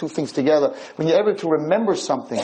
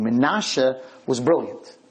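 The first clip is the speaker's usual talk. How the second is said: ordinary delivery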